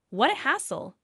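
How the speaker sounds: ordinary delivery, nothing marked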